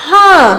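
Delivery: ह with the vowel hummed through the nose; nasalized vowel. Shown as a demonstration. Teacher showing children chandrabindu, nasalized ह. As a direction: draw out ह as a nasal हँ. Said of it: "इसको हम बोलेंगे".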